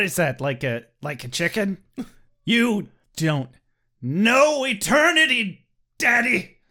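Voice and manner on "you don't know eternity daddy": slow, louder, whiny defiance; defiant teen. Pretend. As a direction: sulk at dad, dragging it out.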